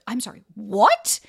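retold disbelief, loud drawn-out "what"; incredulous. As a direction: act out stunned disbelief, slowing into a loud question. Retelling shocked reply to breakup remark.

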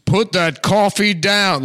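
loud, high, slow Glengarry bark; Alec Baldwin shouting. Retold or imitated. Host imagines Baldwin barking the Glengarry line, loud and slow. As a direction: bellow it like a furious sales boss. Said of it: "Alec Baldwin just being like".

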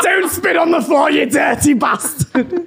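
laughing, shouted Scouse telling-off; middle-aged Scouse mum. Acted. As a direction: scold like a Scouse mum, dragging out the ending. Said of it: "middle-aged mum when you go scouse".

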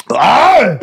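sharp high gasp at the sour taste; a loud pained cry from a sour bite. Real, not acted. Eating a whole lemon.

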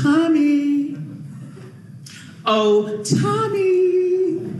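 high, sung-out elegant mother calling; lovely voice. Retold or imitated. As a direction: croon the name sweetly and high. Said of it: "in a lovely voice".

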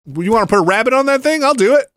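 high, loud overeager shouting; eager hyped shout. Retold or imitated. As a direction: play Ubisoft as a desperate fan. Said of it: "They're like".

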